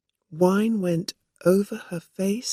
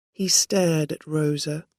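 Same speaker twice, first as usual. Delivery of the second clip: usual speaking voice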